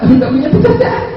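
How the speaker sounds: high, loud wail in imitation of weeping; mock crying complaint. Preacher voices Heri crying over having no job, exaggerated wail. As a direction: wail it as the jobless man. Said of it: "Nangis, kenapa?".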